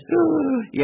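high imitated intake of air; mock gasp for breath. Patient struggling to answer about his wife, mock gasping. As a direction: gasp hard before answering. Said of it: "lying there gasping for breath".

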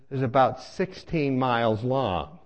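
usual speaking voice

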